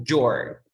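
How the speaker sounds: "your" drawn out slowly as one syllable, yor; slow model contraction. Shown as a demonstration. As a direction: stretch "your" so the class hears the contraction. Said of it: "En lugar de decir you are happy, digo".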